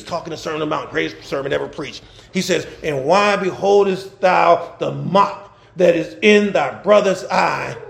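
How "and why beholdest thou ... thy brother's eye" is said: slow, lower, measured recitation; scripture reading. Acted. Pastor reading Jesus's sermon to the congregation, measured. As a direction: read the verse out deliberately.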